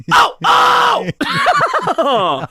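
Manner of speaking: high, loud screamed "oh"; Sam's scream. Retold or imitated. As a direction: howl out two big cries. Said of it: "it's not so much the screaming part".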